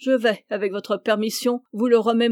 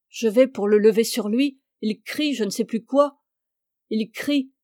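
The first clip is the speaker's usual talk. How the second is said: ordinary delivery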